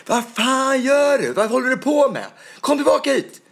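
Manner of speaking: high, loud shout of outrage; angry yelling. Retold or imitated. Guard at the entrance challenging the dancer, angry shout. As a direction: bellow it like a furious guard. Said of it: "skrek på mig".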